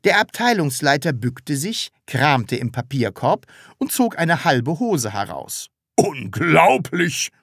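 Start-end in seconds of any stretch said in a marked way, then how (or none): 5.9-7.3 slow, raised-pitch outburst; outraged exclamation. A narrator's reading. Department head is appalled by the seller, outraged exclamation. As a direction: stretch the word out in outrage. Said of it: "rief er".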